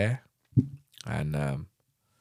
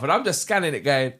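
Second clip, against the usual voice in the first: ordinary talk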